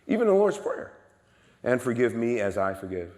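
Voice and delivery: high, loud pulpit emphasis; forceful preaching. Real, not acted. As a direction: drive the point home to the congregation, voice soaring.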